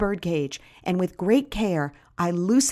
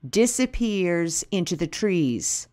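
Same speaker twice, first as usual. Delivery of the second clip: usual speaking voice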